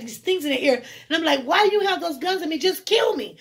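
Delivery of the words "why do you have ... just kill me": high, fast, desperate pleading; desperate plea. Retold or imitated. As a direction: beg them to end it, panicked. Armed men pointing guns.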